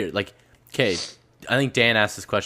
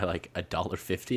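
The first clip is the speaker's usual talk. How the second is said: ordinary talk, nothing marked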